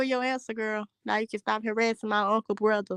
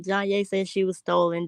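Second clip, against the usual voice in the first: ordinary talk, nothing marked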